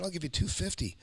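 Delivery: high, keen overbid; eager and quick to outbid; eager offer. Retold or imitated.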